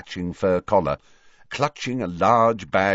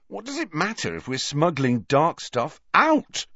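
put-on higher-pitched, indignant boy; indignant demand; 8 semitones higher than usual. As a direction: demand it as if it's absurd. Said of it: "demanded Ron".

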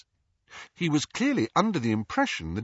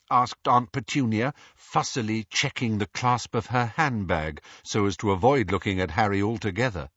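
ordinary delivery, nothing marked

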